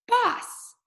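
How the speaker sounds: "Boss" yelled up high with a falling end; screamed name. Acted. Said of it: "Jackson kind of screams it".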